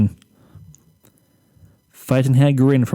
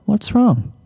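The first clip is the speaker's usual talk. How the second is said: higher, gentle worried pony voice; 9 semitones higher than usual. Acted. Gentle pony calls her rabbit.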